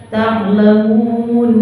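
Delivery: "Ta'lamun" with a moderately held u; two-count madd. Shown as a demonstration. Teacher showing the shortest length, chanted two-beat madd. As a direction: hold the u of "Ta'lamun" two beats. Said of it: "Dua boleh".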